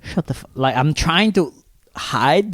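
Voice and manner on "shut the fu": urgent whisper, cut off short; secretive hushing. Retold or imitated. Teller hiding behind her father to silence her, acted whisper. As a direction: hush someone under your breath.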